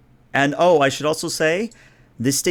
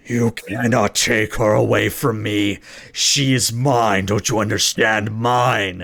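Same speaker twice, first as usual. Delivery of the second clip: slow, gravelly roar held back in volume; gravelly monster screech; slower than usual. Acted. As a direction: rasp possessively like a transformed beast prince. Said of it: "sort of that gravel ... kind of a screech".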